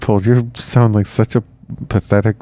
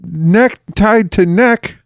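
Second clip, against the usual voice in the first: high mock voice; 11 semitones higher than usual. Mocking colonial ruler voice.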